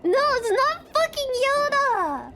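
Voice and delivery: high, loud exasperated protest; loud, offended correction; indignant. Real, not acted. Mock protest at chat's wrong guess.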